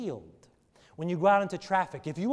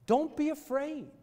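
normal talking voice

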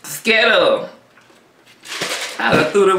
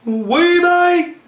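hyped intro call; 9 semitones higher than usual. Shown as a demonstration. Repeating the hyped intro line.

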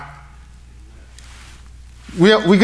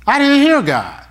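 high whiny mock protest, pitched up; a churchgoer's mocking whine, pitched up; mock excuse; 11 semitones higher, slower than usual. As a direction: play the lame excuse of a listener who didn't hear God, high and whining.

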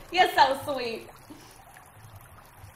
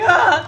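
imitated cold yell; 7 semitones higher, 14 dB louder than usual. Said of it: "The guy just yells when he's cold".

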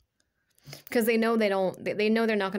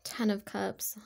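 soft unvoiced whisper, much quieter; whispered card names; 8 dB quieter, slower than usual. Real, not acted.

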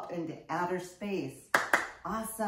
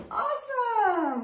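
high, loud, drawn-out cheer; teacher cheering the kids on; excited praise; 5 dB louder, slower than usual. Acted. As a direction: celebrate the class's work.

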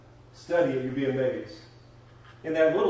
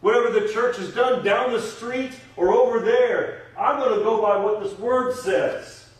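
high, loud pulpit resolve; fervent preaching; 5 semitones higher, 6 dB louder than usual. Real, not acted. Preacher vowing to serve the King every day, fervent. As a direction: declare it forcefully, punching key words.

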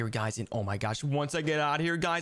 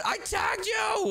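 high, slow shout of victory; triumphant shout; much slower than usual. Acted. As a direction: yell it out as the winner. Catching brother in game.

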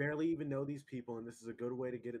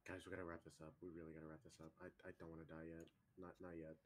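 low, very soft pleading; fearful undertone; 6 semitones lower, 15 dB quieter than usual. Acted. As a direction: speak low so you won't be found. Scared they are about to die.

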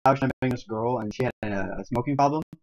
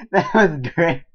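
slow, laughing through it; 4 semitones higher, 6 dB louder, slower than usual. Real, not acted. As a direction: laugh out the words.

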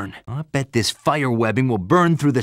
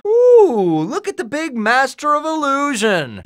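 high, swooping mock awe; mocking taunt; 10 semitones higher, 4 dB louder than usual. Hero jeering at big master of illusion, mocking awe. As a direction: coo it sarcastically at a show-off.

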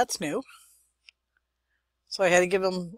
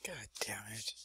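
frustrated whisper under the breath; muttered to nobody; whispered curse; 6 semitones lower, 15 dB quieter than usual. Real, not acted. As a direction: hiss the curse quietly to yourself.

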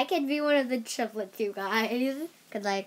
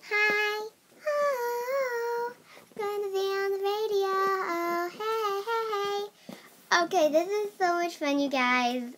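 high squeaky helium singing; chipmunk-high voice from helium; 9 semitones higher, 3 dB louder, slower than usual. Real, not acted. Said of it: "I'm gonna do that again".

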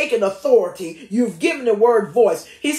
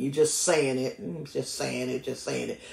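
low, soft, trailing repetition; rote recitation; 9 semitones lower, 9 dB quieter than usual. Shown as a demonstration. Showing rote recitation of scripture.

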